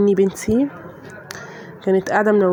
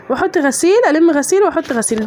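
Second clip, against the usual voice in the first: fed-up complaint; 6 semitones higher than usual. Real, not acted. Laundry never ends, fed up.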